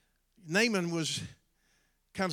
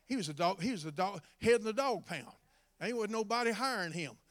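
ordinary talk, nothing marked

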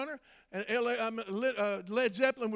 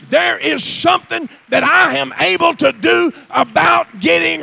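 high, shouted, building preaching; full-force preaching; 8 semitones higher, 16 dB louder than usual. Acted. Preacher shouting to the church that lepers are all the same. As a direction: preach it louder and louder.

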